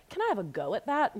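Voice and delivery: imitating a small child's Peppa Pig British accent. Son asking mom for a turn, imitated British accent. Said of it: "you say it with a British accent".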